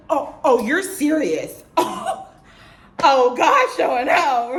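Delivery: high, loud gasping disbelief; stunned delight. Retold or imitated. New hire hears the biggest number, high stunned delight. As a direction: reenact being floored by great news. Retelling shock at the top offer.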